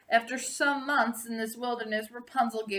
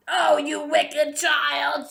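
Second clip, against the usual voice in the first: loud, slow, furious witch shriek; shrieking sorceress; 6 semitones higher, 7 dB louder, much slower than usual. A narrator's reading. Sorceress shrieking at Rapunzel over her betrayal. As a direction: shriek it out at the wicked child. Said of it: "she shrieked".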